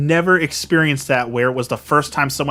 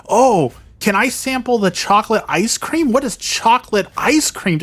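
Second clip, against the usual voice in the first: mocking a clueless customer; 8 semitones higher than usual. Vlogger imitating the restaurant lady, high and ditzy. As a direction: mimic her naive questions in a high voice. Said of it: "She's like".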